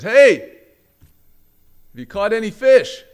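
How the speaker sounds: high, loud, drawn-out holler; long-range shout, words stretched; calling out. Retold or imitated. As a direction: yell it out across the water. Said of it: "he yells out there".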